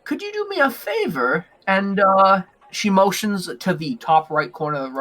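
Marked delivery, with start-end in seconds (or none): 0.0-1.4 high, hesitant request; small elf woman. Acted. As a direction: ask the favor in a high little voice. Elf woman asks a favor.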